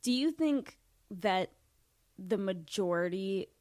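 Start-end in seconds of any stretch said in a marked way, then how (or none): none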